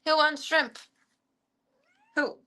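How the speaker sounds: high sing-song call to the cats; pet call. Real, not acted. Calling all the cats to the shrimp, high and lilting.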